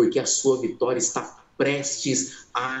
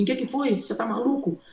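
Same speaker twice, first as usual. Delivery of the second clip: startled doctor; 9 semitones higher, faster than usual. Retold or imitated. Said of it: "Aí ela falou assim".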